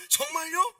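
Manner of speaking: very high, loud acted surprise; amazed question. Crying girl hearing he rose again, amazed. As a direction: burst out in eager disbelief. Girl's eager reply, acted.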